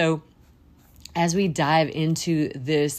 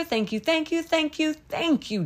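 much higher, rapturous thank yous; fervent thanks; 10 semitones higher than usual. Real, not acted. Host thanking Jesus at the end of prayer, much higher joyful praise.

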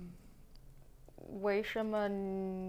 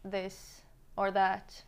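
"You give me this or that, why?" spoken slowly; slow trial; much slower than usual. Shown as a demonstration. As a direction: work it out slowly, word by word. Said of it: "feel like this is tricky".

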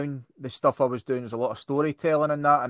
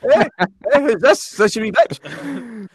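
nephew's line, repeated; 9 semitones higher, 5 dB louder than usual. Pretend. Customer confused by a punchline without its setup, asking in a mock-puzzled voice. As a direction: ask "wee bitch" like a baffled punter. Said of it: "the next customer's gonna go".

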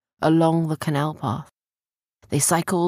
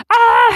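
very high, loud yelp of fright; spooked shriek; 12 dB louder than usual. A narrator's reading. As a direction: shriek in sudden alarm. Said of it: "suddenly got spooked".

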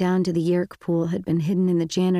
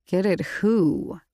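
suspicious; slower than usual. A narrator's reading. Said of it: "Marco asked suspiciously".

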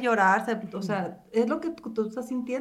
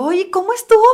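high, loud excited asking; eager question; 7 semitones higher, 9 dB louder than usual. Retold or imitated. Retelling her eager question to friend.

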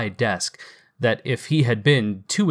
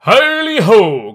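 much higher and louder hearty holler; jovial shout; 10 semitones higher, 11 dB louder, slower than usual. Acted. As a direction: call out the boys like a jolly master. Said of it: "cried old Fezziwig".

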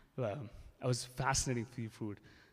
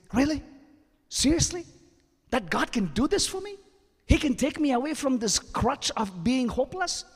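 high, loud, overjoyed mock gushing; hammed-up amazement; 13 dB louder than usual. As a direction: play the convert overdoing his joy. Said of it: "No, I didn't say that".

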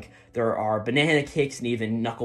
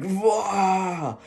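high, drawn-out "waah" scream; mock scream; 7 semitones higher than usual. Eiji freaking out over his brother, mock scream. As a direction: imitate a scream without much extra volume. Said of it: "he just screams".

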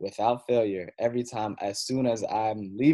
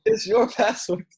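slow, much higher, louder mock protest; exasperated; 12 semitones higher, 10 dB louder, slower than usual. Son mocking his mom for forgetting her own password, slow and exasperated. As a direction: drag it out in disbelief.

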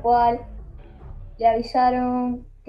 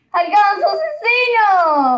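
dramatic shout; 11 semitones higher, 8 dB louder than usual. Pretend. Horror story punchline.

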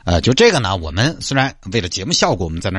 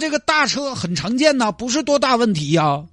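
very high-pitched, dismissive reassurance; exasperated traffic officer. Acted. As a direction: brush off the panic in a squeaky put-on voice. Acts officer dismissing the worry. (尖嗓子不以为然地说)

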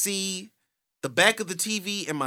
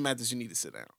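quiet, lower stern teacher; teacher's stern order; 4 semitones lower, 10 dB quieter, faster than usual. Retold or imitated. Teacher quietly telling Mathis to sit, stern. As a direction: tell him firmly to sit down. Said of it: "She said".

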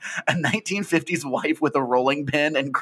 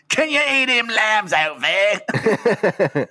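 high, loud, drawn-out Cockney; Cockney Hannibal Lecter; 10 semitones higher, 5 dB louder, much slower than usual. Pretend. Joking Hannibal asks about lambs, loud hammed-up Cockney. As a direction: holler it like a hammed-up Cockney chimney sweep. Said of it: "Hannibal Lecter as the Cockney eighteen eighties chimney sweep".

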